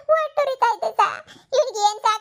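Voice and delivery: mother wailing to the doctor about her poisoned son; crying. Acted. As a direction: break down sobbing over her son.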